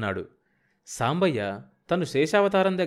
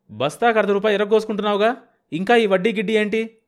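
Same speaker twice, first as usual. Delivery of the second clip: rude outburst; 9 semitones higher, 6 dB louder than usual. A narrator's reading. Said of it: "దురుసుగా అన్నాడు సాంబయ్య".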